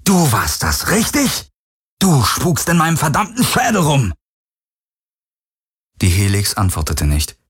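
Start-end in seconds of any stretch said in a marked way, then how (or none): 0.1-4.1 higher, pitch jumping in outrage; angry accusation. Acted. Garland accusing the Helix of haunting him, in rising outrage. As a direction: accuse it, voice cracking upward.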